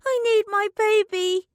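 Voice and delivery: put-on high, loud child's shriek. A narrator's reading. The little girl shrieks for her baby, high and loud. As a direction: shriek for the lost doll. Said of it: "shrieked the little girl".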